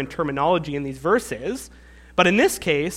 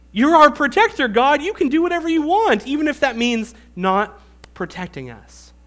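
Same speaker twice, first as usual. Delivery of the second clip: sarcastic complaint; 4 semitones higher than usual. Retold or imitated. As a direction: needle God with mock praise. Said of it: "it's almost like".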